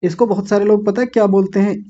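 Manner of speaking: usual speaking voice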